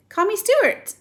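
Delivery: put-on boy begging the bird to use his name; eager plea. A narrator's reading. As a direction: press it warmly and eagerly. Stuart insists eagerly.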